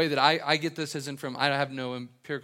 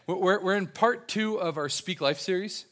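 ordinary talk, nothing marked

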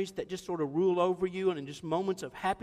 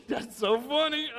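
stifled laughing; 6 semitones higher, 4 dB louder than usual. Retold or imitated.

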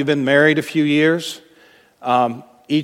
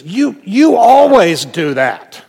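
louder, higher, slow accusation with stressed You; accusing snap; 6 semitones higher, 8 dB louder, slower than usual. Acted. Spouse in a conflict, hurling a blaming accusation. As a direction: fling the blame at your spouse. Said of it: "you say something like this".

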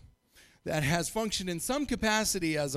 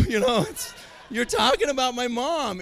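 laughing indignation; 6 semitones higher, 5 dB louder than usual. Real, not acted. Still indignant after the quote.

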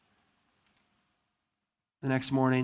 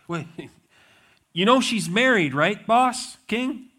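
incredulous servant; 8 semitones higher, 7 dB louder than usual. Retold or imitated. Servant questions the king's order.

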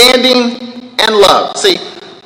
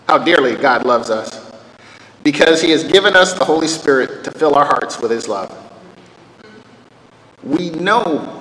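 lower, soft solemn reading; scripture reading; 8 semitones lower, 5 dB quieter than usual. Acted. Preacher to congregation, reading the verse solemnly.

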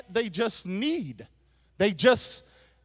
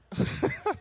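high breath of laughter; short laugh at your own joke; real laugh; 10 semitones higher, 3 dB quieter than usual. Real, not acted. Preacher amused by his own joke, laughing.

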